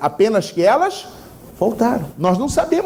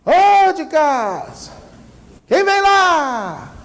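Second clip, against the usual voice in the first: high, slow call from the doorway; 7 semitones higher, much slower than usual. Acted. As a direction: ask who's out there in the dark. Homeowner calls into dark.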